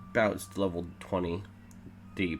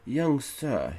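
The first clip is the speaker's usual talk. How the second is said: put-on higher, louder British old man; hammed-up British plea; rattling British voice; 7 semitones higher, 5 dB louder than usual. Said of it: "he cried in a rattling voice that I sound British for some reason".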